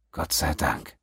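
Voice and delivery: relieved sigh. Acted. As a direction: breathe it out in relief. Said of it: "Pennington seufzte erleichtert".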